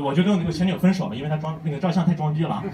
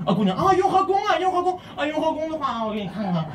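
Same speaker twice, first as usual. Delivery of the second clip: put-on girl's voice; 9 semitones higher than usual. Girl answering in higher voice.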